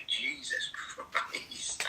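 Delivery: breathy whisper, slowly drawn out; whispered exasperation. Real, not acted. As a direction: sigh it out under your breath. Exasperated aside before telling story.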